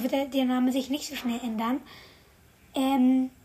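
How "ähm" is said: ordinary talk, nothing marked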